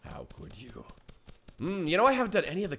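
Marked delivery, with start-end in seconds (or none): 0.0-0.9 low, very soft reproach; hammed-up broken murmur; mock heartbroken. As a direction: drop to a faint, wounded whimper. Plays grieving shallot parent.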